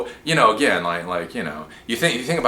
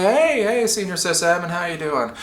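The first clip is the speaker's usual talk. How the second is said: high, friendly mock greeting; help desk newbie; 10 semitones higher, 4 dB louder than usual. New help desk guy greeting senior sysadmin, in a high voice. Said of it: "I'm the new guy on the help desk".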